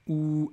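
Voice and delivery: "ou" held long; prolongation. Shown as a demonstration. Teacher explaining the rule, "ou" prolonged. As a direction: lengthen "ou" as one sound. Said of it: "ça va être une prolongation".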